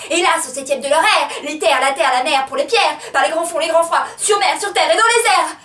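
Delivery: loud, climbing litany; relentless, agitated cry; frenzied declamation. Acted. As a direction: drive the list up to a high shout. Frenzied climax of monologue.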